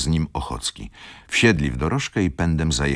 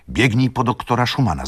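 higher and louder, sending him running; urgent call; 7 semitones higher, 4 dB louder than usual. A narrator's reading. Szlangbaum sends the servant for the doctor, urgent call. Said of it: "zawołał".

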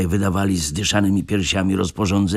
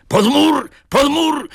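very high, loud yelled warning; frantic order to get under the wall; urgent shout; 6 dB louder, slower than usual. A narrator's reading. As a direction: scream them to take cover. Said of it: "krzyczał mały rycerz".

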